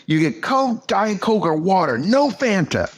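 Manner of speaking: mock the fed-up waitress refusing you; angry waitress. Retelling a waitress in Jerusalem, mock angry waitress voice. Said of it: "really angry".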